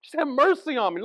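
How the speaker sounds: high, loud begging; a beggar crying out for mercy; pleading cry. Retold or imitated. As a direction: plead with everything left. Beggar's plea repeated.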